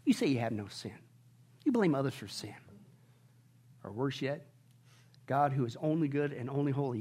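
ordinary talk, nothing marked

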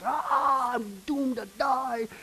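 high, slow mock wail of doom; hammed-up dread; imitating terror. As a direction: cry out like a man who knows he's finished. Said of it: "they flip out and freak out".

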